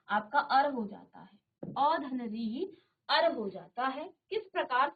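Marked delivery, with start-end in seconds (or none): 1.6-2.6 अ धन री drawn out syllable by syllable; slow, spelled out. Shown as a demonstration. Teacher breaking अ धन री into slow parts. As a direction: stretch each part of अ धन री for the class.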